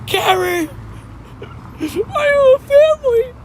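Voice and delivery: high-pitched squealed yell; mock yelling. Streamer mimicking chat yelling at them, high mock yell. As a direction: shriek it way up high, playfully. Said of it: "Everybody yell at me".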